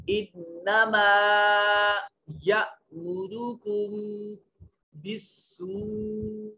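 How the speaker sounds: slow, melodic tajweed chant with long held vowels; Quran recitation. Shown as a demonstration. Teacher recites the next verse for students, slow melodic chant. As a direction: intone the verse slowly and evenly.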